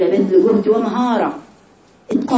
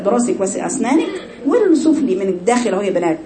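ordinary talk

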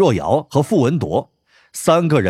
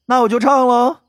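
cheeky announcement; 11 semitones higher than usual. A narrator's reading. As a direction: chirp it out cheekily. Bai Ruoyao's voice in the story.

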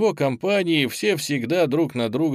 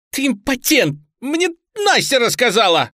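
high, loud, venomous jeer; screeched taunt; 12 semitones higher, 7 dB louder than usual. A narrator's reading. Bound boy spilling a secret to captor, screeched taunt. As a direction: hurl it shrilly to wound.

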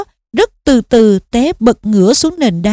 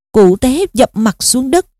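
ordinary delivery

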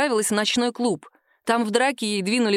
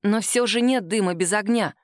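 ordinary delivery

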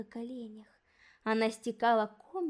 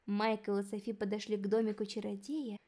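usual speaking voice

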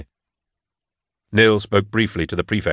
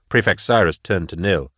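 ordinary delivery

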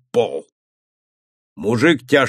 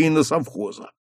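ordinary delivery

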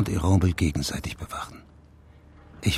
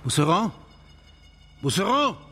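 calling a name into the house, pitch climbing higher; 9 semitones higher than usual. Acted. Searching the house for the missing Mousseron, calling out. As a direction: call out anxiously for him.

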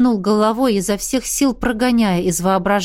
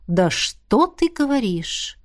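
slow, higher, mocking disbelief; sarcastic; 4 semitones higher, slower than usual. A narrator's reading. Izergast doubts Miranda's excuse, sarcastically. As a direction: draw it out with sarcasm. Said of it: "с сарказмом произнёс Изергаст".

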